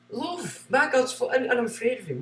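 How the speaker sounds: high, soft mock-timid voice; mock timid believer. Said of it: "Oh, I'm afraid".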